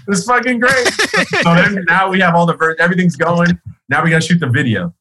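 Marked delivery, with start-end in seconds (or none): 0.1-0.9 thrilled disbelief. Retold or imitated. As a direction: gasp it out in happy relief, pitched way up. Hears [name] loves the verse.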